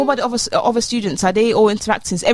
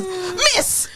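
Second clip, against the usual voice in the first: mock whiny protest; 8 semitones higher, slower than usual. Retold protest to teacher.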